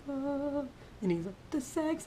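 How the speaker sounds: lightly voiced embarrassed son; small high voice of a caught kid; shy little boy. Son answering dad shyly.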